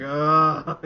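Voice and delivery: loud disgusted ugh; loud groan. Retold or imitated. Viewer groaning at another reference, loud disgusted groan. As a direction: groan at the screen. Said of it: "I was just groaning".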